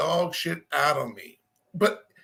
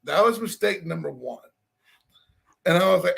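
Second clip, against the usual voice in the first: usual speaking voice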